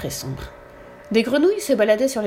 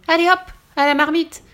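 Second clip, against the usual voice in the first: loud, high witch's call; sling the prey into the pot; gleeful command; 9 semitones higher, 7 dB louder than usual. A narrator's reading. Witch tossing the ogre into her pot, calling out.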